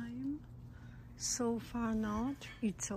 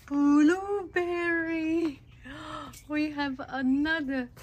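excited gasp; 5 semitones higher, 9 dB louder than usual. Real, not acted. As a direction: gasp then cry out the word with joy. Garden harvest delight.

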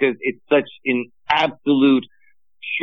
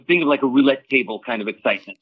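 ordinary talk, nothing marked

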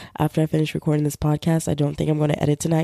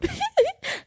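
high laugh. Real, not acted. Laughing at the story.

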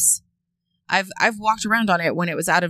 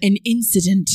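put-on British accent; 4 dB louder than usual. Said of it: "I leaned quite far into the mic so that it sounds, I went British".

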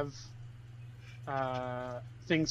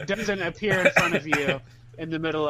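laughing; 10 dB louder than usual. Real, not acted. Joking about the rainbow message.

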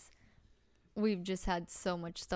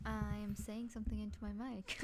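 much quieter, level delivery; very soft voice; 11 dB quieter than usual. Real, not acted. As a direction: say it softly, barely driving the mic. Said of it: "Your voice is very soft".

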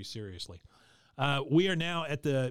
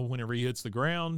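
ordinary talk

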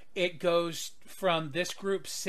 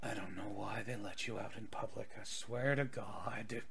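weary mutter; 5 semitones lower, 12 dB quieter than usual. Acted. Wolf man grumbles quietly while watching the pirates brawl. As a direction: mutter it under your breath, fed up.

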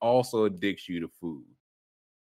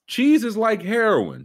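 high, slow mock announcement; mock quote; 12 semitones higher, 4 dB louder, slower than usual. Host hamming up the mayor's quote. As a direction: read it with mock gravity. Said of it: "mock reading the mayor's quote".

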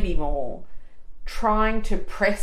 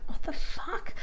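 whispered; 10 semitones higher, 3 dB quieter, slower than usual. Retold or imitated. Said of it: "just going".

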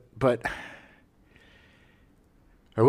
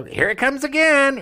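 put-on singsong quote, pitched way up; mock catchphrase; 14 semitones higher, 4 dB louder than usual. Host imitating the kid teasing a comedian, high mock voice. Retold kid's spooky catchphrase.

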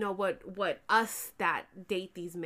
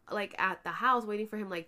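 ordinary delivery

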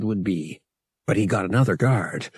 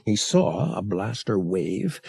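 ordinary delivery

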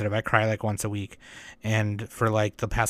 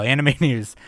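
laughing; 3 semitones higher, 6 dB louder than usual. Real, not acted. Laughing while restarting the segment.

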